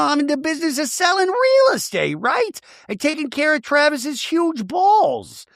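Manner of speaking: high, hammed-up declaration; high-pitched parody of self-justification; mock earnest. Hammed-up parody of Gina's speech.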